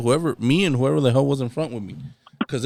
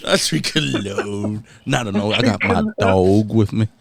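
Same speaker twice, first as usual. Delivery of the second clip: slow, lower, drawn-out "I drink alone"; mock lonely drinker; 6 semitones lower, slower than usual. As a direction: croon it like a sad country song. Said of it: "he's gonna hit you with".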